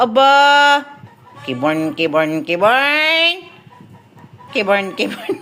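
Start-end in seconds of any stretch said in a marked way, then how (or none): 1.4-5.3 low, slow, stretched-out name; coaxing name-call. Real, not acted. Coaxing the sulking dog by name. (mababa at mabagal na paglalambing)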